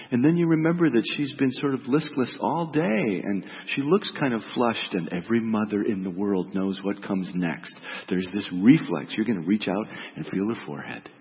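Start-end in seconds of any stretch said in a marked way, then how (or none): none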